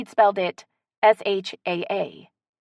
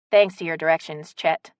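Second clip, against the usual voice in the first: plain reading voice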